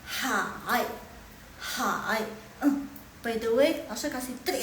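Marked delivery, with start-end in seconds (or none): 0.1-2.2 HAI dips down the hill, then climbs back up; third tone. Shown as a demonstration. Teacher demonstrating third tone to class, dipping and rising. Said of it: "nada ketiga kita turun gunung dulu".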